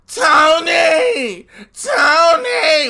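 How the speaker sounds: whiny crying shout, very high; crying, worn out. Retold or imitated. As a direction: wail his name like a crybaby. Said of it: "with that whiny, crying ass voice".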